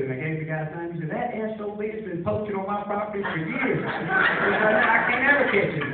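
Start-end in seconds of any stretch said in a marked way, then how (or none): 1.1-5.9 louder, slowing rant about the poacher; a farmer loudly cursing a poacher; fuming farmer. Retold or imitated. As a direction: vent it like a fed-up landowner.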